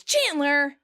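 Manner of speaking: high retold yell of a name; exasperated yell, slightly held back; mock yell. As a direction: call out in mock outrage. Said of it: "Rachel yells".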